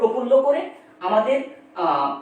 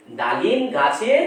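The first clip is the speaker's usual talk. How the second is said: ডালিম গাছের drawn out slowly as one unit; slow recited phrase; 3 semitones lower, slower than usual. Shown as a demonstration. Teacher marking a foot of the poem, slow and deliberate. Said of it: "এটা একটা পর্ব".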